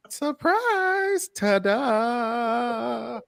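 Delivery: high, sing-song reveal; playful ta-da. Acted. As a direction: announce it like a magic trick.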